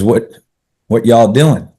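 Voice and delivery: Southern drawl. Retold or imitated. Young Texan asks two strangers, imitated Southern drawl. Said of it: "Southern drawl was new".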